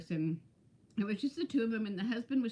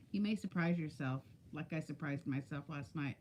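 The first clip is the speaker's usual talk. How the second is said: ordinary talk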